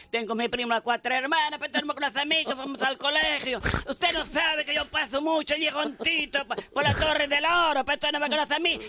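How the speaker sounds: put-on high-pitched Sevillian accent, chatty and wheedling; Sevillian prankster. Prank caller from Sevilla teasing don Carlos, high Andalusian accent. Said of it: "Que le llaman".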